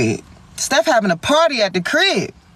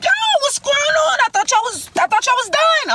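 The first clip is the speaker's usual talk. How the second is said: much higher, incredulous question; shocked disbelief; 12 semitones higher than usual. Retold or imitated. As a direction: act thrown off by the news. Friend shocked they're talking.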